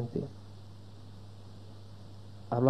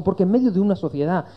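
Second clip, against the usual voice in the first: high, loud, impassioned imitation of a sceptic; arguing friend; 8 semitones higher, 5 dB louder than usual. Preacher acts out his friend's case against Christianity, high insistent harangue. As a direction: mimic an opponent driving the argument home, voice raised high. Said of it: "Me dijo".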